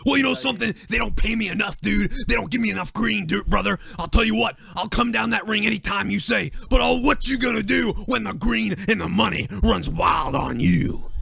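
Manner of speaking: high, hammed-up Hulk Hogan voice with dude and brother; mock Hogan cutting a promo; Hulk Hogan impression. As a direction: ham it up like a wrestling promo. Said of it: "He'll do anything".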